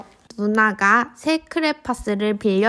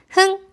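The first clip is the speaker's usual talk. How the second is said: huff; 9 semitones higher than usual. Acted. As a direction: huff like a sulky kid. Boy huffs.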